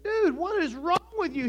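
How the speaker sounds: high-pitched mock exasperation; exasperated friend. Friend confronts the sad heir, exasperated. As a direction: demand an answer, comically fed up.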